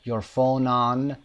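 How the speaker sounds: your phone on run together very slowly; linked words. Shown as a demonstration. Teacher slowly modelling linked speech for students. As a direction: glide phone into on with no gap.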